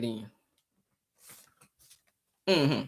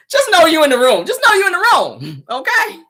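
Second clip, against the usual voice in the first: knowing tease; 9 semitones higher, 4 dB louder than usual. Acted. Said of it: "I'ma say it like that".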